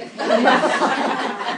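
big loud burst of laughter. Real, not acted. Laughing with the congregation at her own joke.

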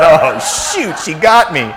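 high, loud laughing aside. Retold or imitated. Dad realizing daughter got him back, laughing loudly. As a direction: laugh through it, caught out.

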